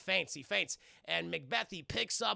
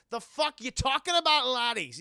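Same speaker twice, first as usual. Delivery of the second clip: hammed-up angry yell in a Scottish accent, higher and louder; angry Scottish villain; 6 semitones higher, 9 dB louder than usual. Macbeth to his mercenaries, mock furious Scottish yell. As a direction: bark at the bungling mercenaries.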